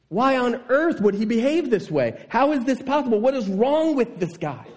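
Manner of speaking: high, incredulous outrage; fired-up disbelief. Retold or imitated. Preacher stirring up the church against the servant, high indignant disbelief. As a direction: ask it as if you can't believe him. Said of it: "this just gets you fired up".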